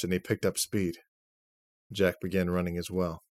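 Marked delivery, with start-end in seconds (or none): none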